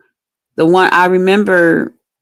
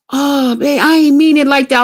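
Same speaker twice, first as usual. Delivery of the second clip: higher-pitched mock pleading; pleading boyfriend; 7 semitones higher than usual. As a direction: imitate a boyfriend backpedalling in a raised pitch. Boyfriend pleading in retold quarrel.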